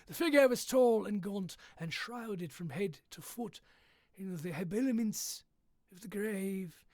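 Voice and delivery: a hint of Hagrid's West Country lilt; lightly voiced West Country giant; a bit West Country. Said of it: "Haggard sounded a little bit, a little bit West Country".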